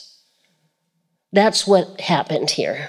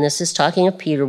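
normal talking voice

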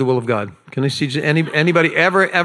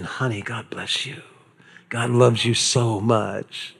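much softer, lower, gentle; tender blessing; 4 semitones lower, 5 dB quieter, slower than usual. Acted. Jesus holding a child, soft tender blessing. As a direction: coo it to a child in your arms.

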